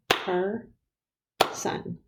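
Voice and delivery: "Per-son" in two separate beats with a pause; split into syllables. Shown as a demonstration. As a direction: clap out "Per-son", each syllable held apart. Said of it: "Listen again".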